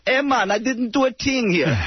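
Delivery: put-on Jamaican voice, drawn out; Jamaican accent. Caller resumes his Jamaican persona.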